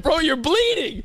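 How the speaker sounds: alarmed shout. Retold or imitated. Friend shouting that his mouth is bleeding. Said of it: "I said".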